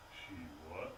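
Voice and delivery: very low, very soft repeat; stunned disbelief. Acted. Man absorbing bad news on phone, hushed disbelief. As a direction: barely voice it, sinking low.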